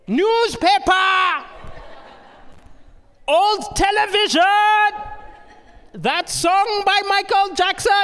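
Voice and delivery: high, loud, drawn-out shouting; mock heckler's yell. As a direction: yell random things across the street as insults. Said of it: "He could have yelled out".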